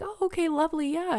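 high, brightly agreeable reply; forced composure. Retold or imitated. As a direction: play it cool in a high voice. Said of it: "keep your shit together".

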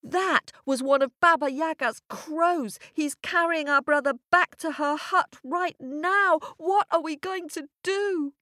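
high, urgent scolding voice; alarmed older sisters. A narrator's reading. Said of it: "shouted the older sisters".

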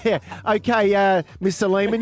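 ordinary delivery

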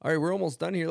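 ordinary talk, nothing marked

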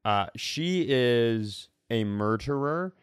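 normal talking voice

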